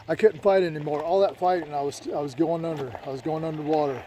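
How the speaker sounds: ordinary delivery, nothing marked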